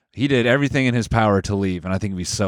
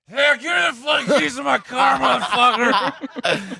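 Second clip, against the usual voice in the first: very high, hollering mock Indianapolis accent; slower than usual. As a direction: shriek it in a cartoonish Indianapolis accent, dragging the last word. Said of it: "Indianapolis accent's like this".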